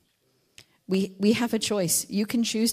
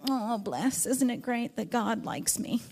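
twee and soft; 3 semitones higher, 5 dB quieter, slower than usual. Retold or imitated. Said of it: "a nice thing that's like".

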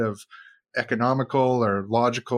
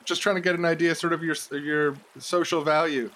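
calling out; 6 semitones higher than usual. Pretend. Calling to drowning kid.

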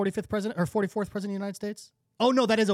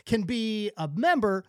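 mock ad read; 4 semitones higher than usual.

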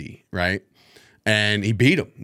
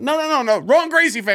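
mock panicked denial; 14 semitones higher, 3 dB louder than usual. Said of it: "And I was like".

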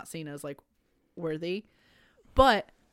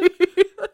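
high giggle; 12 semitones higher, 12 dB louder than usual. Real, not acted. Laughing at Agamemnon joke.

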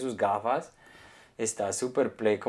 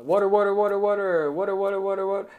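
high, louder chant of WATER, WATER; looped vendor call; 10 semitones higher, 5 dB louder than usual. Retold or imitated. Man retelling a water seller's recording on the bridge, high looped call. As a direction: imitate a recorded street hawker on a loop. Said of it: "En loop diciendo".

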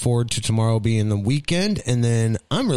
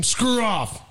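mock snap; 10 semitones higher than usual. Snapping back after politeness.

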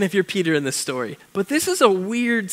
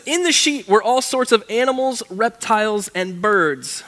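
louder, lifted reading voice; scripture reading; 3 semitones higher, 4 dB louder than usual. Acted. Pastor reading the animals in the sheet, lifted reading voice. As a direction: announce the passage with energy.

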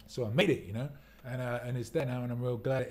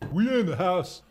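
high, loud whoop of a line; hyped call-out; 7 semitones higher, 9 dB louder than usual. Real, not acted. Streamer hyping up the viewers, loud high call-out. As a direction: call it out big, pitch swooping up. Repeats hype call, raised voice.

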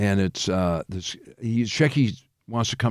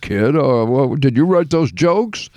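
put-on higher old-comic patter; choppy, pitched-up comedian voice; Rodney impression; 3 semitones higher, 4 dB louder than usual. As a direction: imitate an old comic sizing up a kid. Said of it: "it was kinda like".

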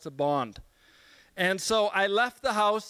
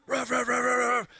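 mock dog barks, a little higher; imitated barking; 4 semitones higher than usual. Guest mimics the dogs barking behind the door, playful barking.

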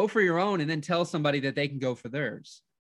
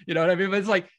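normal talking voice

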